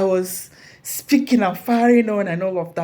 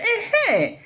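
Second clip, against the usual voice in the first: high, loud joyful cheer; imitating cheering colleagues; 7 dB louder than usual. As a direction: welcome her back with a laughing cheer. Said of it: "And they said".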